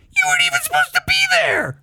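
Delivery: very high, fast falsetto squeak; tiny piercing falsetto, quick; high-pitched voice. Shown as a demonstration. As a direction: push it up into a squeal. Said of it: "Like, is hi-, higher than that".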